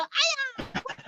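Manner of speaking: high-pitched mock yelp; mock scream. Teacher imitating someone who was touched, mock scream. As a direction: act out a startled cry, pitched high. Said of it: "이러고 소리를 질러".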